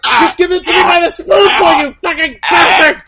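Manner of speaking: rampaging sheep attacks the farmer, hammed-up shout; raging sheep. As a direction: bellow it like a crazed sheep. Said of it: "I'm gonna be a Welsh sheep on a rampage".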